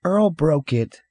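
put-on high, slow child's accusation; tattling boy. Boy blaming brother to a stranger, tattling child voice. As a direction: tell on him like a sulky kid. Stephen tells on his brother.

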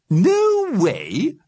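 high, loud questioning repeat; baffled echo. A narrator's reading. Missus Liberty queries the Alderman's slang, baffled.

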